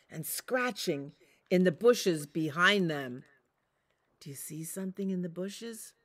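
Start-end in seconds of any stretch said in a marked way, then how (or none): 4.2-5.9 fast, scared whisper. Acted. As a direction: hush it so the bushes won't hear. Scared pups in the dark.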